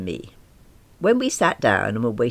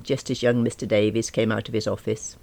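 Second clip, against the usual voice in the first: ordinary delivery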